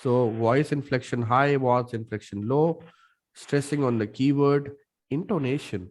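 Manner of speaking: ordinary delivery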